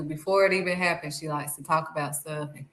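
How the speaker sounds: ordinary talk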